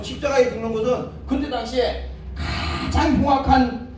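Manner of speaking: ordinary delivery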